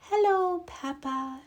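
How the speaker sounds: high, soft fairy whisper. A narrator's reading. Tooth fairy greeting the sleeping girl, high soft whisper. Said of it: "she whispered".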